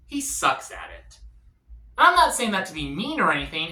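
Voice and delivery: ordinary delivery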